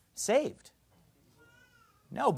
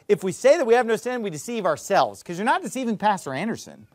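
usual speaking voice

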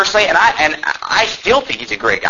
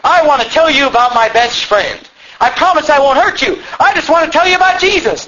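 high-pitched, hammed-up earnest testimony; earnest converted bully; 9 semitones higher than usual. Saved bully telling kids about Jesus, mock earnest. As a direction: play an eager comic-book convert.

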